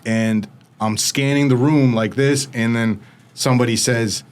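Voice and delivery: ordinary delivery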